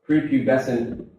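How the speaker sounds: ordinary delivery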